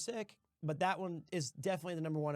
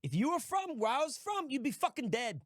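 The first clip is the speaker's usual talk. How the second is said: put-on fast, brash Boston kid, pitched way up; Boston Scout accent; 8 semitones higher than usual. Streamer imitates the Scout for a Boston accent request, brash Boston accent. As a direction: spit it out like a cocky Boston street kid. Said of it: "He goes".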